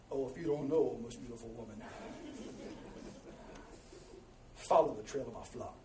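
low, smooth crooner impression. Shepherd answering his beautiful woman, low imitated seducer voice. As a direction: croon it deep and soft, an attempted soul-singer voice.

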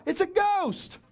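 panicked disciple yelp; terrified cry. Acted. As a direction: cry out at a ghost. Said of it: "they cried out".

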